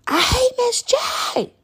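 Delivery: put-on high, slow, crying child voice; child's whiny cry. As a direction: mimic a wailing upset kid. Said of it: "so I have someone".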